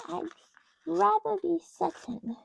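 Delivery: ordinary talk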